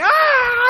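sudden high shriek imitating a frustrated toddler; toddler freak-out shriek. Dad imitating his son's pajama tantrum, high shriek. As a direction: squeal like a baby who hates his sock. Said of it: "He's like".

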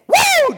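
a high, loud joyful whoop; excited whoop. Real, not acted. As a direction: let out a triumphant cheer. Preacher cheers at fulfilled promise.

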